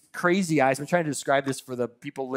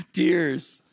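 ordinary delivery

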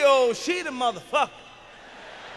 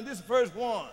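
ordinary delivery

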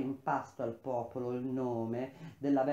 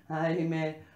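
rising wail of lament, louder each time; crying out aloud; 3 semitones higher, 6 dB louder than usual. A narrator's reading. Seer consumed by fear hears the voice coming, crying out. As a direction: lament climbing into a cry. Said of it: "gridai ad alta voce".

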